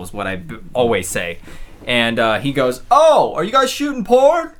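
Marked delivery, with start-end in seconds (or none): 2.9-4.5 high, loud, drawn-out mock question; excited onlooker. Onlooker to film crew at a window, excited mock voice. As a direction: imitate a stranger thrilled by his own guess.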